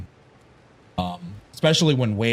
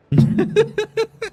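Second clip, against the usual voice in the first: a sudden high burst of laughter; real laugh; 7 dB louder than usual. Real, not acted. Laughing at own joke about the British empire, high burst of laughter. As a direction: crack up at your own joke.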